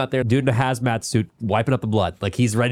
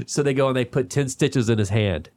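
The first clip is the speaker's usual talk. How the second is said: ordinary talk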